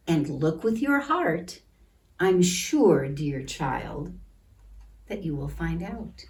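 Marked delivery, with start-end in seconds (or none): none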